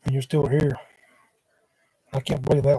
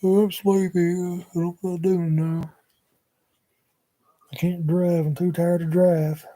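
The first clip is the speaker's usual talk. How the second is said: mock sleepy whine; 4 semitones higher than usual. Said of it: "and I say".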